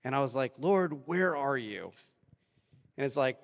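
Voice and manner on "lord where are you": slow anguished plea. Retold or imitated. Retold desperate prayer to God.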